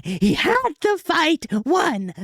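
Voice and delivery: heated outburst. Real, not acted. Fan ranting to viewers about the lost fight, high and drawn out. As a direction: wail the words like you can't believe it. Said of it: "Apologies for getting heated".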